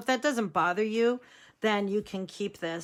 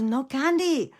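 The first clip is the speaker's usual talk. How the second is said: annoyed grandfather, imitated Mexican accent, higher; Grandpa Joe; 6 semitones higher, 3 dB louder than usual. Grandpa losing patience with granddaughter, mock-cross Mexican accent. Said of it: "he starts getting really mad".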